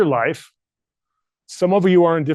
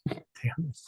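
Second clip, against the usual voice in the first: barely audible, tearful curse; swallowing tears, very soft; choked up; 12 dB quieter than usual. Real, not acted. Speaker to himself as tears come, barely audible. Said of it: "don't cry".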